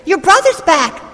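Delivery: excited father begging his son to come in; pleading exclaim. Acted. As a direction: coax him in, urgent and bright. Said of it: "begins to plead with him, exclaiming".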